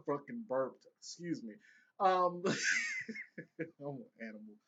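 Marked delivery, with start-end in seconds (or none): 2.5-3.5 high-pitched giggle after a burp; high squeaky laugh. Real, not acted.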